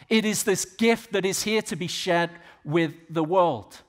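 Stephen crying out. Retold or imitated. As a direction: cry it out boldly to a council. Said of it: "Stephen cries out before the Sanhedrin".

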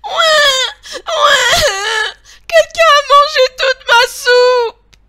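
loud, high, bawling wail; crying baby bear. A narrator's reading. Tiny bear finds his bowl empty, high bawling cry. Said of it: "il se met à pleurer".

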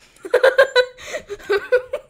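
high loud burst of laughter. Real, not acted. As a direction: crack up loudly.

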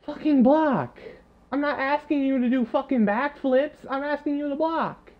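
furious gamer venting; frustrated yelling. Real, not acted. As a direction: rage at useless teammates. Yelling at his offensive line.

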